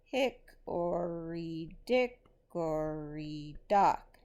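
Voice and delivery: slow, heavily stressed reading, each beat marked; slow rhythmic dictation. Shown as a demonstration. Teacher dictating a nursery rhyme for students to mark stresses. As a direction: read it out slowly so students can mark the beats. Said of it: "Here is what it should look like".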